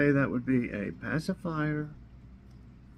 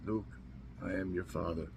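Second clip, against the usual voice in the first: lower, quieter deep movie villain line; a lightly done deep villain impression; movie villain quote; 7 semitones lower, 11 dB quieter, faster than usual. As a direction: drop the voice low for the famous father line.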